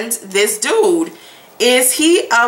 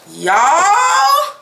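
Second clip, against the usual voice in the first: much higher, stretched y'all; excited high y'all; 13 semitones higher, 3 dB louder than usual. Real, not acted. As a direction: call out y'all in delight. Long high call.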